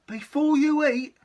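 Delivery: high, loud call; little mouse crying out to stop; mouse calling wait. Acted. Mouse continues.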